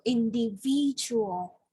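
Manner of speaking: "individual" said slowly, stress on the third syllable VID; teacher's slow demo of "individual", third syllable stressed. Shown as a demonstration. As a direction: model "individual" slowly, leaning on in-di-VID-ual. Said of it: "The third syllable, the third stress".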